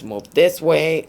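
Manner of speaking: retold yell at kids in the restaurant; slow mock yell. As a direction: call it out slowly like herding the kids. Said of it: "I'm yelling at the kids".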